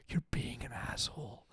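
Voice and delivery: imitating a hushed, voiceless whisper in the ear; whispered scolding. Wife to husband showing off at a wedding, whispered scolding. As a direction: whisper it as a private reprimand. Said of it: "whisper in my ear".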